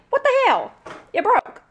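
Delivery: high exasperated cry; indignant surprise. Real, not acted. Annoyed about her broken ring light, high indignant. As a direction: complain in disbelief.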